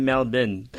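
'Melbourne' as MEL-bn, R dropped; silent R. Shown as a demonstration. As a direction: swallow the second syllable of 'Melbourne', Australian style. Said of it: "yung mga R nila talagang sinasilent".

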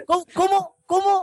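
high, stunned repeated how; can't believe what he's hearing; incredulous disbelief. Real, not acted. Friend reacting to the cushion story, incredulous.

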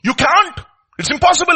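normal talking voice

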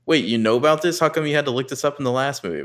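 high, loud mock disbelief; mock surprise. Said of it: "And I'm like".

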